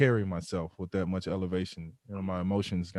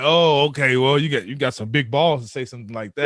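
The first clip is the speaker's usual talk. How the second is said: mocking teammate; 3 semitones higher, 9 dB louder than usual. Teammate mocking the bold rookie.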